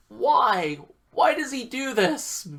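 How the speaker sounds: high-pitched, mock-despairing plea; exasperated lament. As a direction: wail it in mock bafflement. Retold exasperated reaction.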